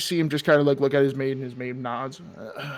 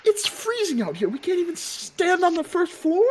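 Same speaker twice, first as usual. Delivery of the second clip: high complaining voice; 13 semitones higher than usual. Acted.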